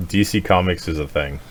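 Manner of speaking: ordinary talk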